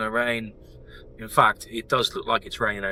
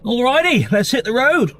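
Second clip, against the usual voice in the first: high, loud announcement of the route; loud, high-pitched road-trip kickoff; upbeat calling out; 10 semitones higher, 8 dB louder than usual. Real, not acted. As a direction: call out the stops with gusto. Setting off, calling out the stops.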